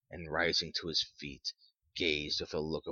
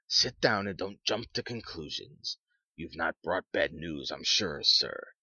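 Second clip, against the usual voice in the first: plain reading voice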